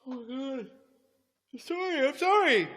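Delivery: high, stretched through a yawn; words pulled out by a big yawn. Real, not acted. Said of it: "I'm yawning".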